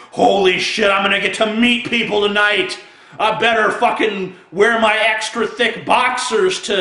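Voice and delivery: mock excitement. Comic parodying joy at meeting strangers, mock excitement. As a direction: overplay eagerness to meet people. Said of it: "It's not like".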